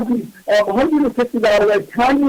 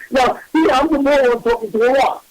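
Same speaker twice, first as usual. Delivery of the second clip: ordinary talk, nothing marked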